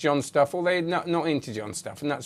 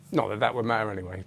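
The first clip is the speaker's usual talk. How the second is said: ordinary talk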